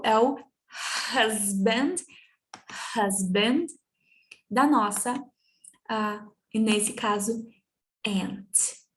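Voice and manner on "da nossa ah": normal talking voice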